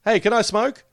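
bright, high casual question; casual high ask. Retold or imitated. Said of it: "you ask the bus driver".

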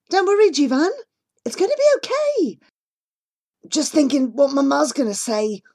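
put-on high, anxious kids' voices; children's voices. A narrator's reading. Two children worrying about their mothers' reaction, high voiced. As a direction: talk like nervous children nearly home.

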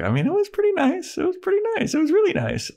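very high squeaky imitation; mock girlish gushing. Buffy coyly telling a friend about her date, mock girlish gushing. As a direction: put on a tiny high-pitched girl's voice.

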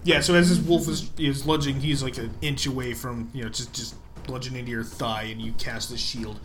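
normal talking voice

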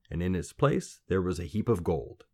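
ordinary delivery, nothing marked

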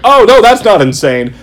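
high, loud gleeful irony; delighted sarcasm. Retold or imitated. Fan reacting to the arc, high gleeful sarcasm. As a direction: gush about the madness. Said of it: "you're like".

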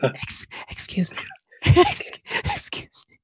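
strained, voiceless interjection; struggling whisper. Acted. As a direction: whisper repeatedly, trying to be heard over others. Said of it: "what if I wanna be heard".

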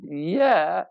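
drawn-out high imitated yeah; mimicking a wife's unwilling yes; hesitant squeak. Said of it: "The hesitation, the tone, the squeak".

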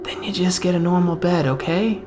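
lightly voiced handler talking a captive round; coaxing captor. Captor to weeping man in a cell, mildly coaxing. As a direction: cajole with a hint of false reassurance.

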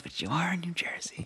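breathy whispered jab; whispered retort. Retold or imitated. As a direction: whisper the comeback.

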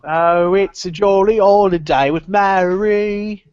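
slow, lightly put-on cockney lilt from Mary Poppins; mock Mary Poppins singsong. Quoting Mary Poppins to the podcast hosts, slow put-on cockney lilt.

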